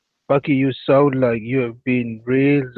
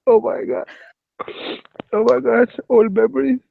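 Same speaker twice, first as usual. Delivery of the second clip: high mock wail of heartbreak; hammed-up high crying; mock sobbing; 10 semitones higher than usual. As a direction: ham up the old heartbreak. Mock heartbreak over old rejection.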